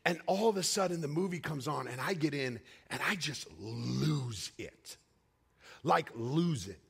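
usual speaking voice

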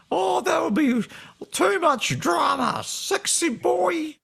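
very high, drawn-out character voice; Darren's voice. Acted. Guest playing Darren reacts to the drama, high character voice. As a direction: draw it out slowly in a high put-on voice. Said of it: "used to be able to do Darren's voice".

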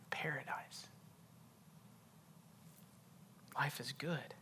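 soft, savoring voice with long pauses; hushed preaching. Real, not acted. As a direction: let each phrase sink in quietly.

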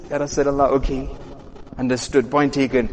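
low, soft, chastened reply; subdued acceptance. Retold or imitated. Umar accepting his leader's reproof, acted subdued acceptance. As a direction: back down quietly, dropped in pitch.

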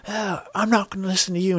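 high whiny defiant voice; mocking defiance. S0 imitating the lord defying Robb, whiny and high. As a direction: mimic a sneering lord refusing to obey. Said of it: "the one guy goes up and he's like".

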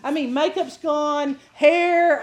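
ordinary delivery

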